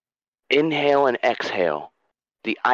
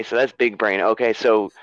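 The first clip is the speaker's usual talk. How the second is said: ordinary delivery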